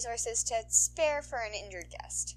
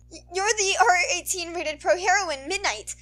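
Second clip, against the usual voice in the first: put-on higher, much louder stammering boy; flustered boy; 11 semitones higher, 14 dB louder than usual. Starstruck boy recognising a famous heroine, stammering. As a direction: blurt it out starstruck. Voices Izuku.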